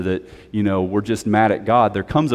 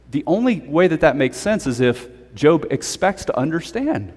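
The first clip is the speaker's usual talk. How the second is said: ordinary talk, nothing marked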